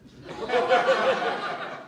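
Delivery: high airy burst of laughter. Real, not acted. Speaker cracking up at his own joke, laughing. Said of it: "laughs after fat joke".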